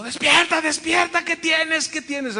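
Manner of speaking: high, alarmed calling to wake her; retold husband's shouts. Husband shouting at his fainted wife, high and alarmed. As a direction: shake her awake with your voice. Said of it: "oigo los gritos de mi esposo".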